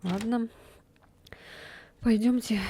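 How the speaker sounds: ordinary talk, nothing marked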